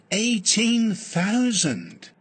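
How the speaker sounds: "Eighteen thousand" said loud, high and slow. Shown as a demonstration. Teacher giving the figure with greater volume and emphasis. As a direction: boom out "Eighteen thousand" slowly. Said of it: "Spoken loudly and slowly".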